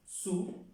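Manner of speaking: "su" pitched high, ending on the vowel; silent final S. Shown as a demonstration. Teacher showing that O and U sound together, demonstrated pronunciation. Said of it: "la S no se pronuncia".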